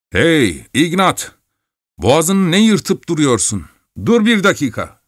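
calling out. Acted. Miller shouting across the snow to Ignat, calling out. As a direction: raise the voice to stop him over the wind. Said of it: "diye bağırdı".

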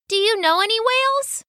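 very high, quick bird voice; quick magpie. Acted. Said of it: "asked the magpie".

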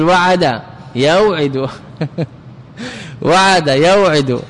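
يوعد slowly split as yaw-ʕidu; original و sounded. Shown as a demonstration. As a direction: stretch out the و inside يوعد. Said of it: "الواو وقعت بين عدوتيها".

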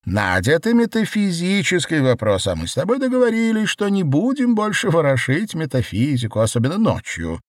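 high, loud, fussy reproof; high vibrating voice. A narrator's reading. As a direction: lecture in a quavering high pitch. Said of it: "высоким вибрирующим голосом".

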